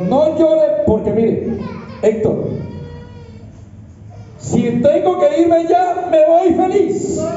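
high, slow, shouted preaching; full-force preaching. Acted. Preacher reliving his dying wife's words, shouting high. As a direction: preach it to the rafters, slowly.

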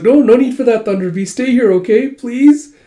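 high, anxious mock begging; pleading. Lord to Thunder Beast walking off, pleading. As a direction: backpedal hastily, ending on a high plea. Lord begs rescuer not to leave.

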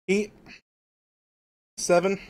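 hiccups breaking the words; hiccuping while eating. Real, not acted. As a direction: talk through hiccups. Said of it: "each hiccup".

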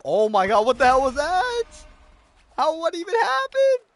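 loud, high, stunned yell; shocked outburst. Real, not acted. Streamer reacting to a crazy goal, shocked yell. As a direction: blurt it out in disbelief.

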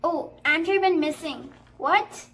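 loud, alarmed and rising; jolted, a character just vanished; startled surprise. Real, not acted.

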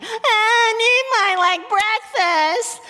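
high, slow whiny child voice with a mock cry; whining. Mom mocking a toddler's whine to her kids, hammed-up whimper. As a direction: ham up a toddler whining for breakfast. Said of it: "Imagine if I went around and went".

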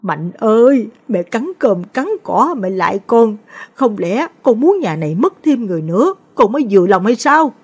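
tearful pleading. A narrator's reading. Mother begging her stubborn son from the ground, tearful high plea. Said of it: "bò ra đất mà khóc".

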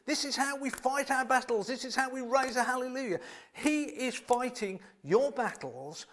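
high, loud, fired-up preaching; excited preaching. Real, not acted. Preacher getting carried away telling the church God fights their battles, excited. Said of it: "Sorry, I'm getting a bit excited here".

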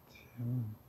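unvoiced breathy whisper. Real, not acted. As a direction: breathe the name. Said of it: "whispered name".

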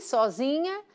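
ordinary talk, nothing marked

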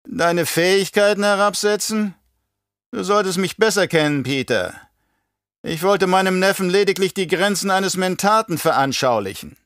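high baron voice, feigning surprise; beschwichtigender Baron. Acted. Baron placates Peter. (hohe Baronstimme, abwiegelnd)

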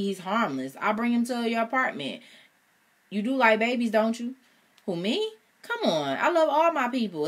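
put-on charming boyfriend pleading his case; smooth-talking boyfriend. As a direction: sweet-talk her into staying.

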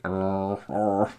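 imitated Wookiee groan; rolling creature growl; Chewie growl. Chewie answering Han's order, imitated growl. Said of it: "Chewie's like".

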